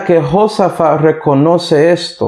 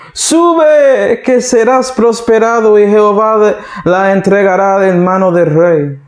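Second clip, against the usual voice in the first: sarcastic mockery; 4 semitones higher than usual. Micaiah mocking the king by parroting his prophets, sarcastic. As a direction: echo the false prophets with exaggerated sarcasm. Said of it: "ahora él está bromeando de él".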